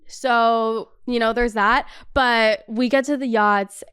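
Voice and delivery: ordinary talk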